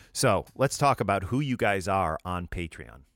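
ordinary delivery

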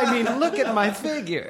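high-pitched, mock-indignant woman character. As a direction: protest in a put-on high woman's voice.

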